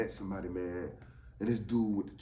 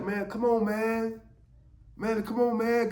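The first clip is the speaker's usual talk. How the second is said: high, louder whiny urging; impatient pleading; 10 semitones higher, 4 dB louder than usual. Retold or imitated. Willie urges the dude from the car, high impatient whine. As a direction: hurry him up, you just want your fix.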